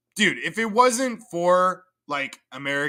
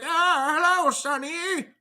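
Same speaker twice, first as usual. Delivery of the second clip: put-on high, airy old-mother greeting, pitched way up; dead mom voice; 11 semitones higher than usual. Streamer imitating a dead mom greeting her son, high creepy voice. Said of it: "being like".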